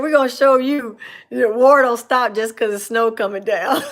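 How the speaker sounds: loud, laughing drill sergeant bark; mock drill sergeant. Retelling the sergeants who made recruits do push-ups, laughing loudly. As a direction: belt it like a drill sergeant, cracking up.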